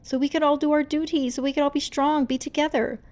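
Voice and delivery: high, loud and hurried plea; begging. Acted. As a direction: beg them to understand. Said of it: "Rumi begged them to understand".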